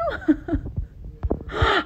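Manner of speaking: laughing through tears; a shaky laugh that tips toward crying; half laugh, half sob; tearful laugh. Real, not acted. Emotional laugh near tears.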